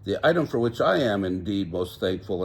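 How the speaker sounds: ordinary delivery, nothing marked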